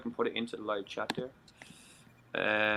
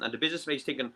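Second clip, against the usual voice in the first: ordinary delivery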